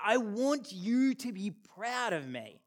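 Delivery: put-on slow, childlike plea; inner child plea. Adult voicing the child in us, slow plea. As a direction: plead like a small boy wanting approval.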